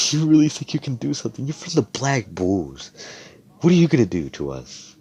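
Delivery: high, fast sneering taunt; mocking mage. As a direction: taunt him like a smug bully, quick and high.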